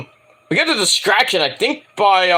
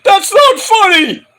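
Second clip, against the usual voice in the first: high, loud, slow mock whine; mocking the champion whining over a mom joke, high and loud; whiny MJF; 14 semitones higher, 7 dB louder, slower than usual. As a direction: play him as a sulking champion. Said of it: "M J's like".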